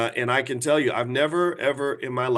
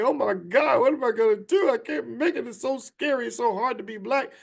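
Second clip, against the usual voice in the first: high, whiny imitation of panic; mock freak-out; 10 semitones higher than usual. Mimicking people going into panic mode, high mock freak-out. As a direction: whine it high as if panicking, mockingly. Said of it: "you wanna freak out and say".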